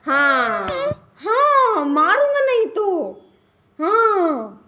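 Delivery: half-joking shrill ultimatum for Aru; mock threat. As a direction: pretend to warn the viewers, hammed up. Mock threat on baby's behalf.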